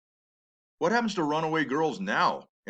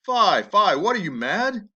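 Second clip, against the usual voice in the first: alarmed mother; 6 semitones higher, 5 dB louder, slower than usual. Acted.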